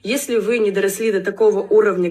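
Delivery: ordinary talk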